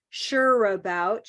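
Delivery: slow, sure about linked smoothly; connected words. Shown as a demonstration. Teacher to class, slow linked demo. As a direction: run "Sure about" together slowly. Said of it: "She connected it".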